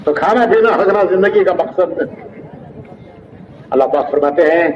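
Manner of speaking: ordinary delivery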